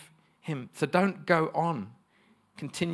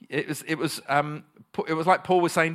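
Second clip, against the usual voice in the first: ordinary talk, nothing marked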